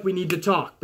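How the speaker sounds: understated firm quiet line; quiet serious "we need to talk"; calm quiet. Retold or imitated. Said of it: "Roman was just like".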